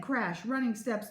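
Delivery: ordinary delivery, nothing marked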